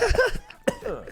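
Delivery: sudden squeaky laugh; high burst of laughter. Real, not acted. Laughs after the quote.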